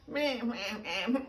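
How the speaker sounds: put-on little whiny baby meh sounds; imitated baby fussing. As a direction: mimic a fussing newborn. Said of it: "might be making sounds like".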